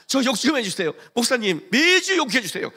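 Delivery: hammed-up eagerness; mock eager pleading. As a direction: beg for it gleefully. Eager begging to be insulted.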